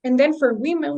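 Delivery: normal talking voice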